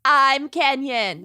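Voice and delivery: slow mock Minnesotan intro; Minnesotan accent. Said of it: "worst Minnesotan accents".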